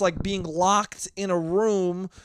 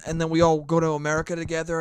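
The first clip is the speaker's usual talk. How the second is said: ordinary talk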